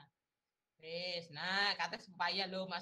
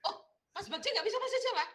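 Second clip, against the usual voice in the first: high, fast astonished question; surprised; 14 semitones higher, 6 dB louder, much faster than usual. Real, not acted. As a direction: gasp it out in disbelief. Kaget Bagja tidak bisa Jawa. (kaget, nada tinggi dan cepat)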